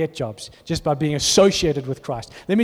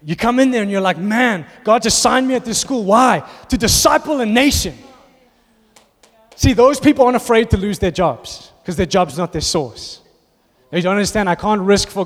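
higher, louder rising sermon build; preaching at full force; 4 semitones higher, 5 dB louder than usual. Acted. Pastor firing up teachers at work, full-force preaching. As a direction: drive it home with rising fervour.

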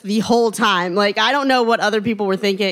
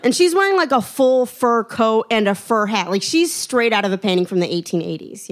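usual speaking voice

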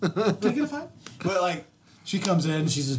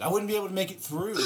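ordinary talk

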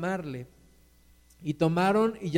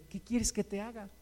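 gentle asking; 11 dB quieter than usual. Acted. Jesus coming into your life asking, gentle quiet question. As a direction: softly put question.

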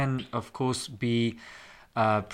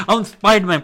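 put-on loud, high excited kid cry; shouted kid superhero; 9 semitones higher, 10 dB louder than usual. Host imitating kids playing Spider-Man, loud and high. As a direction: belt it like a child playing hero. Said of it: "all the kids going around going".

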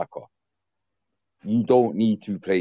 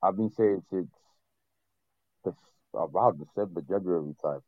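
softer, slightly distant phone level; a bit quiet; 5 dB quieter than usual. Real, not acted. Podcast guest with phone put away a little, a bit quiet. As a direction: trail down a little too quiet to hear well. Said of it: "you sounding a bit quiet".

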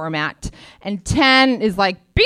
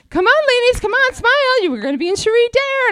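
much higher, bright coaxing sing-song; chirpy stylist; 14 semitones higher, 4 dB louder, faster than usual. Retold or imitated. Stylists urging the models to smile, in a high sing-song.

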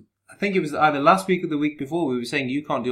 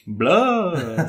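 higher vampire growl; 6 semitones higher, 4 dB louder than usual. Pretend. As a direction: say it like Dracula. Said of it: "I can do a good, um, vampire".

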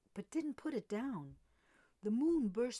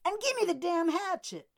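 loud, higher angry order; angry demand; 9 semitones higher, 10 dB louder than usual. A narrator's reading. Jordan demanding the hatchet back, angry shout. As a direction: demand it with fury. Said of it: "Jordan rolled".

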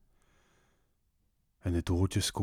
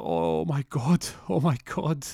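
high, slow, drawn-out wail; mock distress; 7 semitones higher, 4 dB louder, slower than usual. Wife hearing of a terrible accident, high acted distress. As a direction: lament it in a put-on woman's voice. Wife told husband is dead.

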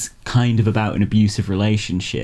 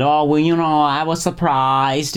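Tommy Wiseau impression; 5 semitones higher than usual. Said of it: "He said".

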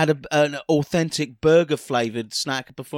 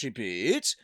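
announcer shout; 6 semitones lower, 7 dB quieter than usual. Acted. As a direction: call it like a jingle. Announcing show segment title.

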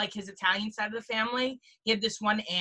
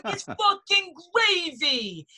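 high, drawn-out scolding holler; hammed-up angry Italian aunt; aunt's mock outburst; 8 semitones higher, 4 dB louder, much slower than usual. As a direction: stretch it out in mock outrage. Mimicking aunt's angry correction.

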